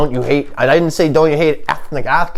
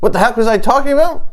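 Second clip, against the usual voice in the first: higher, rapid self-quote; mock bewilderment; 11 semitones higher, much faster than usual. Comic losing his train of thought after pain, mock bewilderment. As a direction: rush it out in higher-pitched mock confusion. Acting out his own forgetfulness.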